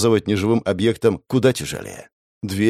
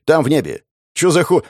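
startled shout; 6 semitones higher, 3 dB louder than usual. Acted. Startled cursing.

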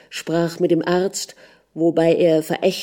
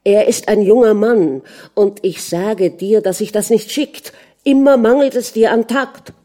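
raised, indignant scolding; higher and louder as the rebuke peaks; indignant reproach; 4 semitones higher, 5 dB louder than usual. Acted. Husband reproaching wife for lack of tact, indignant.

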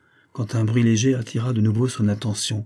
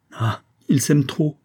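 slow, higher, louder cry of despair; despairing outburst; 6 semitones higher, 4 dB louder, slower than usual. A narrator's reading. Queen bursting into tears before the officer, slow and despairing. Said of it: "éclata la reine avec désespoir".

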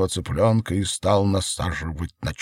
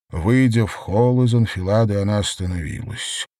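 usual speaking voice

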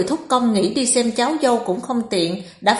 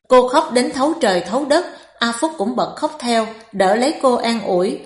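plain reading voice

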